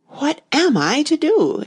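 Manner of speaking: perplexed high exclamation, slow; exclaiming. A narrator's reading. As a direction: cry out in confusion. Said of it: "exclaimed Alice".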